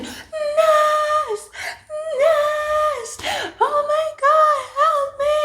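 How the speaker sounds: very high, drawn-out wail; pained cry for help. Retold or imitated. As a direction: wail for help as in labour, hammed-up. Calling nurse in labour pain, retold.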